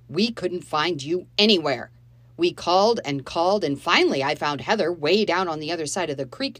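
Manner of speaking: loud, accusing man; angry stepfather. Acted. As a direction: lay into the children. Dave berating the children.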